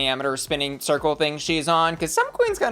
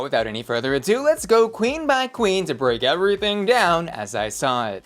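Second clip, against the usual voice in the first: usual speaking voice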